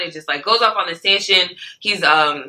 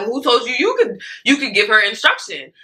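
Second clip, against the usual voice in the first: angry; 6 semitones higher than usual. Retold or imitated. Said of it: "he kinda goes off on her".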